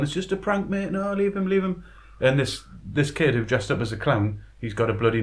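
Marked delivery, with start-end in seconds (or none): 0.0-1.8 high, imitated pleading; mock appeal to leave his mate alone; pleading cameraman. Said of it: "the guy behind the camera's going".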